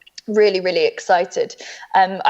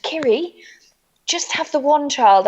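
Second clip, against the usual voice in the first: imitated mother, higher and slower; advising mother; 6 semitones higher than usual. Mother advising her daughter on adoption, slow and pointed. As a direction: spell it out slowly in a higher voice. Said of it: "And she said".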